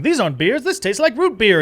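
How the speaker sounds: playfully object, raising it; silly denial. Shown as a demonstration. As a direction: example of denying the scene's reality in mock outrage. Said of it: "I'm not going".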